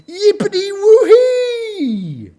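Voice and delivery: very high, loud whoop; squealed, jubilant hurrah; cheering. A narrator's reading. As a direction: whoop long with sudden joy. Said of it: "cried the animals of the zoo".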